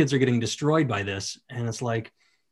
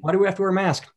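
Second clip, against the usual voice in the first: put-on higher, curious kid voice; lightly voiced curious kid; child's question; 7 semitones higher, much faster than usual. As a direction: ask it like a small child. Voicing his kid asking.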